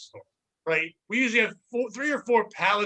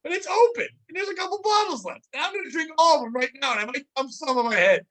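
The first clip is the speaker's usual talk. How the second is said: mock rant; 7 semitones higher than usual. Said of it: "I go".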